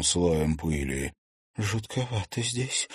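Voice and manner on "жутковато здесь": uneasy. Acted. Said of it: "поёжилась Василика".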